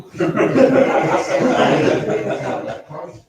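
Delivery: a burst of real loud laughter at his own story. Real, not acted. Recovering man laughs at his own humiliating hospital story, loud laugh. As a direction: laugh out loud, breathy and unvoiced.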